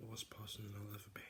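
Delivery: a dark whispered admission. Pretend. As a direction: breathe it out like a guilty secret. Dramatic reveal in whisper.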